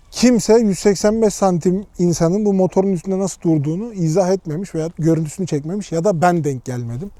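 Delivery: ordinary delivery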